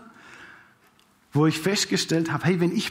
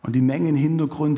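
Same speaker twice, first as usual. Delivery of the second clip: usual speaking voice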